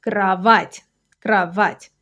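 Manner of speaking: кро-ВАТЬ, weight on the final syllable; stressed last syllable. Shown as a demonstration. Teacher showing where stress falls, last syllable stressed in кровать. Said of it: "The stress is on the last syllable".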